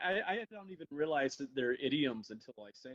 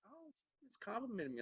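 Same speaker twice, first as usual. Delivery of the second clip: high, soft mock innocence; naively pleased; 9 semitones higher, 11 dB quieter than usual. Grandson fooled by grandmother's sweet insult, naively pleased.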